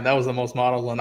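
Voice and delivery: ordinary talk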